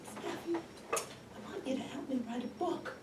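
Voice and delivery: put-on high, soft old mother voice; elderly mother. Mother asking daughter for help with a book, high and frail. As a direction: ask sweetly in a small, high old lady's voice. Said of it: "she called me in after we got dressed and she said".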